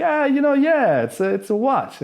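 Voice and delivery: high cheery dumb voice; goofy tourist. Pretend. Comic stalling the man who wants the watch, high and cheery. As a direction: act innocently baffled. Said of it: "I'm like".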